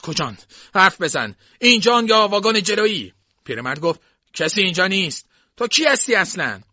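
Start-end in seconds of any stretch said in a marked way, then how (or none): 0.0-3.1 escalating interrogation, getting louder and higher; forceful demand. A narrator's reading. Intruder interrogating the old cook. 4.3-6.6 loud, raised-pitch challenge; indignant old man. A narrator's reading. Old cook challenging the intruder.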